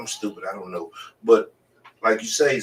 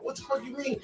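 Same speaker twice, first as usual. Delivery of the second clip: retold disbelief; 8 dB quieter than usual. Friend says it's her fault.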